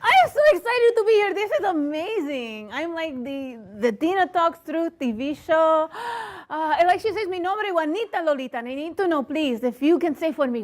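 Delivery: put-on gushing guest with a Spanish accent. Comedian greeting the TV audience, in a put-on Spanish accent. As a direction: lay on a Spanish accent, excitedly. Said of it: "I don't talk like that".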